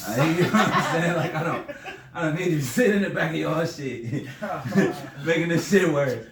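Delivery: loud laughing retelling; laughing loudly. Real, not acted. Telling friends about the cops, laughing loudly. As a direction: laugh through the words.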